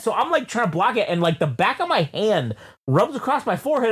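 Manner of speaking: normal talking voice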